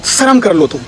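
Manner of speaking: ordinary delivery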